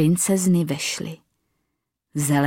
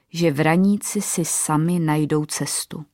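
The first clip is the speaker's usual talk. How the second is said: ordinary delivery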